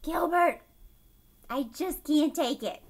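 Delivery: fed-up wail at Gilbert; loud exasperated plea; exasperated cry. Retold or imitated. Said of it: "he's like".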